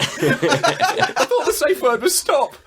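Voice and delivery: high, slow pleading; hammed-up, high-pitched despair; mock victim wail. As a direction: stretch out a desperate plea for laughs. Voicing suffering bound player as joke.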